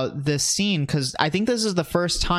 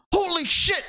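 way higher put-on yelp of shock. Host retells [name] spotting [name], hammed-up high yelp. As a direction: squeal it like a startled rapper. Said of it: "And he goes".

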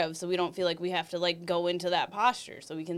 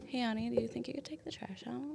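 soft whispered sweet ask, much softer; 5 semitones higher, 12 dB quieter than usual. Shown as a demonstration. Practising a soft request to her man, sweet whisper. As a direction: coax him gently, barely voiced. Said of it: "Well, practice".

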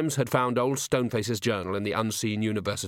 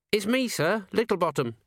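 put-on young dwarf voice; 9 semitones higher than usual. A narrator's reading. Littlebottom the dwarf speaks.

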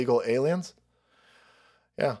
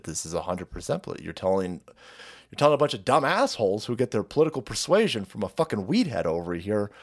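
ordinary delivery

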